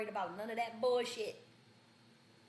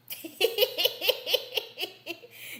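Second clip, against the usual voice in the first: loud high cackle; 11 semitones higher, 7 dB louder than usual. Real, not acted. Laughing at own joke.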